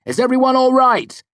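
high, loud call without panic; bold, concerned shout. A narrator's reading. Hero checking on people in the fire, boldly shouted. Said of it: "Bold but concerned".

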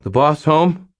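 nervous teen acting relaxed; lightly voiced strained casual; forced casual. A narrator's reading. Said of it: "trying to sound casual".

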